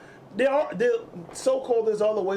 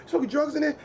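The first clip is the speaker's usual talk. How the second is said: much higher accusing question; 10 semitones higher than usual. Retold or imitated. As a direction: confront dad, mock shocked. Confronting dad in story.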